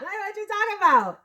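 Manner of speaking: very high, incredulous squeak; baffled elders. Retold or imitated. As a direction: act outraged disbelief.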